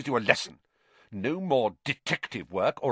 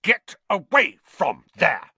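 slow, loud, high command; pompous indignation; 8 semitones higher, 7 dB louder, slower than usual. Acted. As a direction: order them away, puffed up. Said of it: "Percy swelled in a manner".

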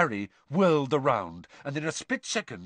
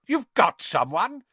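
high, loud, incredulous cry; raised, astonished voice; disbelieving shout; 7 semitones higher, 5 dB louder than usual. A narrator's reading. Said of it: "shouted Mister Crouch, sounding highly disbelieving".

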